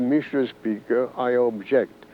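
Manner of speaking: ordinary talk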